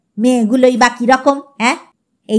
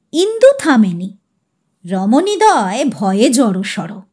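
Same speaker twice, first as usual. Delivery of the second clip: ordinary delivery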